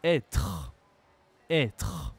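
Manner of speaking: low, falling "Être" with an open è; circumflex like grave. Shown as a demonstration. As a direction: say "Être" as EH-truh, deliberately and low. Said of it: "on prononce comme un accent grave".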